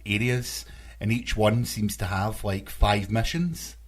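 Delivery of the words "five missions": ordinary talk, nothing marked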